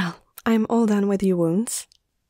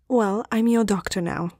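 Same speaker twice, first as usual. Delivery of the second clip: usual speaking voice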